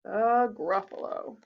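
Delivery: put-on lively read-aloud voice for children; storybook narration. A narrator's reading. Reading The Gruffalo to children, lively storyteller. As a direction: tell it like a bedtime story. Reading picture book to kids.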